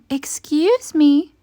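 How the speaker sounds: high, slow little cricket voice; polite cricket. A narrator's reading. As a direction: clear your throat and speak up gently. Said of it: "coughed a cricket".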